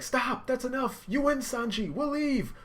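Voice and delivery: high pleading yell; pleading scream. Retold or imitated. Nami screaming at Sanji to stop. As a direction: beg him to stop at full voice. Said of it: "Nami's like".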